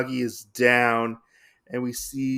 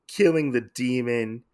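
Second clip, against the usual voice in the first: usual speaking voice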